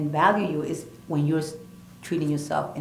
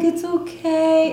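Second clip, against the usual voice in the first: high, slow, drawn-out comforting voice; soothing reassurance; 12 semitones higher, 6 dB louder, much slower than usual. Retold or imitated. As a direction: gently soothe a crying friend, stretched and lifted. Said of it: "and I'm like".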